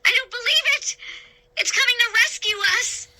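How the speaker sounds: high, fast, thrilled cry. A narrator's reading. Girl cheering the rescue ship, high thrilled cry. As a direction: gush with disbelieving joy.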